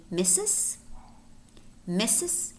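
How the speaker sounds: Missus with no R, MISS-iz; silent R. Shown as a demonstration. Teacher models silent-letter word, Missus said MISS-iz. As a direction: say "Missus" as MISS-iz, rising at the end. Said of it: "in which the letter R is silent".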